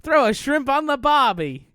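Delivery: loud, high mock Australian accent; Nicky the Aussie. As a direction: belt it out in cartoon Aussie. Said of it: "Nicky the Aussie".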